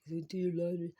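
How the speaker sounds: loud, high, pointed "kindly" command; mock kindly. Telling her host to do his laundry, loud and pointed. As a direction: say it sweetly but loud enough to sting. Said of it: "So I said kindly".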